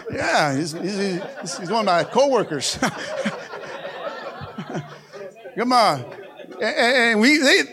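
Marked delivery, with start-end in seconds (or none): 0.1-6.0 laughing through the words; giggling mid-sentence at a friend; real laughter. Real, not acted. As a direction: chuckle as you point him out. Laughing at coworker in crowd.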